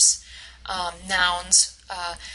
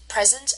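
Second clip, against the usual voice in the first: "present" as PRE-sent, front stressed; first-syllable stress; 3 semitones higher than usual. Shown as a demonstration. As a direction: hit PRE in "present", the noun. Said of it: "the stress is on the first".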